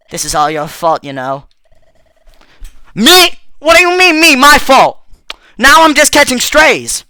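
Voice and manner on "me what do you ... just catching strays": high, loud indignant protest; indignant outburst. Acted. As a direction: fire it back, offended and loud. Robot accused of causing the fault.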